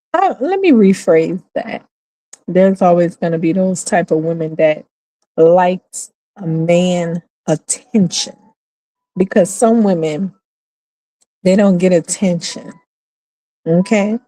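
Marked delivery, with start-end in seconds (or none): none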